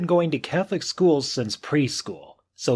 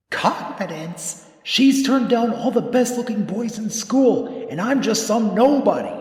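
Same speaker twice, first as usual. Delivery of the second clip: high, nervous self-doubting laugh; 9 semitones higher than usual. A narrator's reading. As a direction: scoff at yourself, pitched up. Tom's anxious self-talk.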